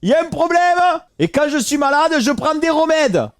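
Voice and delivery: hammed-up so-what challenge; mock outrage, daring them to object; defiant comeback.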